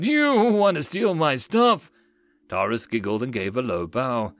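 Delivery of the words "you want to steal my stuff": high, slow outraged accusation; crying out. Acted. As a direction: accuse them in a high, indignant cry. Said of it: "the merchant cried out".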